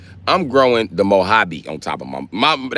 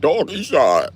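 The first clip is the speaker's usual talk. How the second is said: east side guys; 8 semitones higher, 3 dB louder, slower than usual. Retold or imitated. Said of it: "they be like".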